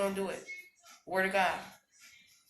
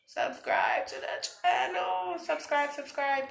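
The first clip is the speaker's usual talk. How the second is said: much higher, louder, slow sing-song plea; playful plug; 10 semitones higher, 5 dB louder, slower than usual. Real, not acted. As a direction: beg for subscribers playfully. Channel plug chant.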